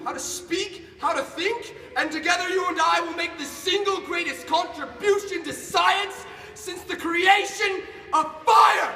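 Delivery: high, loud speech building to a shout; grandiose fervour. Acted. Scientist's rousing vow to creature.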